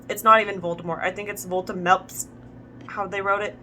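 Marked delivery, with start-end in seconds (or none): none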